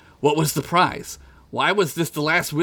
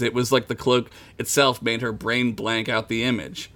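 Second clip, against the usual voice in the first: ordinary talk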